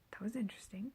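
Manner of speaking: dry whisper under the breath, amused; an unvoiced, bemused murmur; wry whispered aside. Real, not acted. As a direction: whisper it as a private joke to the camera.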